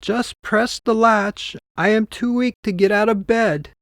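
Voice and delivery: wolf pretending to be a frail old woman; wolf faking weak grandmother. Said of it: "the wolf had weakly responded".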